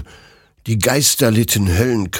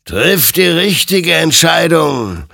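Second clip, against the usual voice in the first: slow, much higher and louder warning; admonishing; 10 semitones higher, 7 dB louder than usual. Acted. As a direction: warn him gravely and slowly. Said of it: "ermahnte ihn sein Gegenüber". (ermahnend, langsam, viel höher und lauter)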